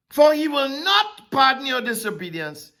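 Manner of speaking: ordinary delivery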